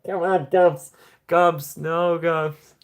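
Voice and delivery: high, loud disbelief; excited. Real, not acted. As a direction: cry out in happy disbelief. Reacting to gift spree.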